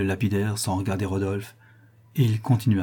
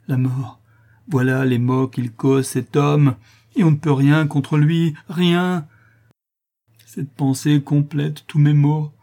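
higher, building louder in bitter despair; despairing lament; 5 semitones higher, 6 dB louder than usual. A narrator's reading. Daughter laments the villain's crimes to gentleman, despairing. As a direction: lament as one who is powerless.

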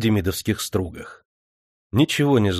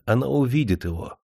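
ordinary delivery, nothing marked

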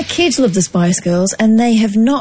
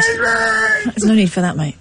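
much higher, indignant mock protest; mock offended; 13 semitones higher than usual. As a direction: play wounded and outraged.

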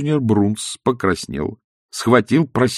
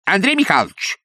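high, raised woman's call; woman shouting; 11 semitones higher than usual. Acted. Wife shouting back at her husband from the room. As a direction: call sharply from the next room. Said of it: "закричал женский голос из комнаты".

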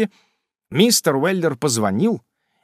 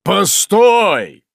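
sharp loud exclamation, higher; exclaiming; 7 semitones higher, 8 dB louder than usual. A narrator's reading. Father interrupting Sam's reading, loud exclamation. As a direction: cut him off with a loud cry. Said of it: "воскликнул".

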